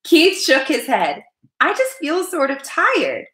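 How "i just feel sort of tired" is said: put-on slow, high-pitched child's complaint; weary child, high and drawn out; tired boy. A narrator's reading. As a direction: answer as a poorly little boy. Sick boy answers his mother.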